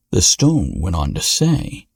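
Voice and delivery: ordinary delivery, nothing marked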